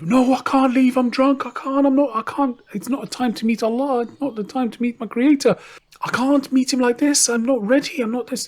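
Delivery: put-on high whiny pleading drunk voice; pleading drunk ruler. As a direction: beg for your life, drunk and terrified. Drunk ruler begs angel of death.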